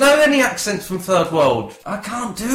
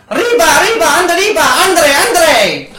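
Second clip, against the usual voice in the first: loud, high cartoon Mexican cry; Speedy Gonzales; 8 semitones higher, 6 dB louder than usual. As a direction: shout it high like a cartoon mouse. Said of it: "that was Speedy Gonzales".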